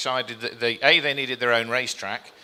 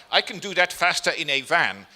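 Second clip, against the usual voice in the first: imitating a German accent, slightly louder; a light mock German accent, a bit raised; 4 semitones higher, 5 dB louder than usual. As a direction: have a go at her German accent. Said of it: "she did it in a German accent, so I'll try".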